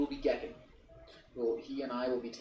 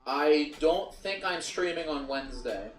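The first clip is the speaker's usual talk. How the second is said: ordinary talk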